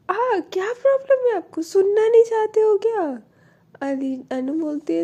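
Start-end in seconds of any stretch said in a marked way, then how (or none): 0.1-3.2 high, loud, exasperated yell; angry shout. Retold or imitated. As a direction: shout it at her, fed up. Alisha snaps during phone quarrel. (ऊँची आवाज़ में गुस्से से चिल्लाना)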